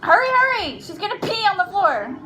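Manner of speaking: high, pressing call with a laugh at the end; real urgent hurrying. Real, not acted. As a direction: hurry your partner along in a panic. Hurries partner to grab toddler.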